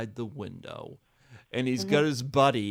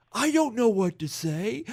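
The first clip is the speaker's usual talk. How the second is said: high, louder mock protest; imitated helpless buddy; 10 semitones higher, 5 dB louder than usual. Buddy put on the spot outside window, high mock-helpless blurt. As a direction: blurt it out helplessly, pitched right up. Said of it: "He goes".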